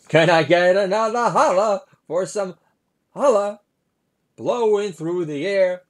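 high, loud hammed-up Obama voice; Obama impression. Comic mocking the president at Thanksgiving, high hammed-up impression. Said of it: "This is my impersonation of Obama".

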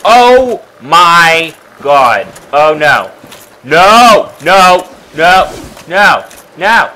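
loud panicked cries climbing to a shriek; panicky, very loud, repeated cries; real panic. Real, not acted. Sudden scare in game.

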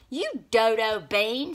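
loud, slow scornful snap; snapping. A narrator's reading. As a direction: insult him sharply. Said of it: "snapped Snap Bean".

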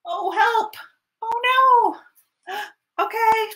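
gasping high cries; panicked. Acted. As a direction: gasp out for help. Gasping for help after crash.

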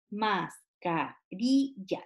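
"mascarilla" split slowly into mas, ca, ri, lla; syllable by syllable. Shown as a demonstration. Teacher breaking a word up for children, syllable by syllable. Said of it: "marque la sílaba tónica".

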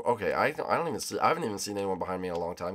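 ordinary talk

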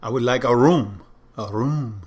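put-on French accent, drawing out "room"; Inspector Clouseau. An Englishman affecting a French accent to ask for a room, mock French. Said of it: "Inspector Clouseau".

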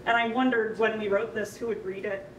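ordinary talk

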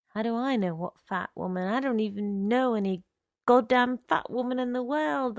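ordinary delivery

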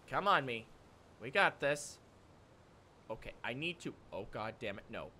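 low, hushed talk to oneself; muttered self-talk. Real, not acted. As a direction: mutter it under your breath. Self-talk while struggling.

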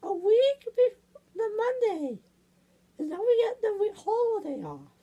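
high falsetto, chatty explaining; high puppet character. Pretend. Character explaining the school calendar, high falsetto. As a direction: keep up a high cartoon girl voice.

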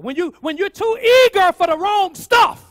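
high, very loud preacher's emphasis; fired-up preaching. Real, not acted. Preacher warning about eagerness for wrong stuff, fired-up preaching. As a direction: drive the warning home at full voice. Preacher drives main point home.

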